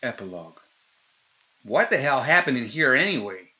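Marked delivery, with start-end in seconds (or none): 1.6-3.5 high, loud, cheeky question; too loud, brazen. A narrator's reading. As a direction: blurt it out far too loudly. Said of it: "asked, a bit too brazenly, too loud".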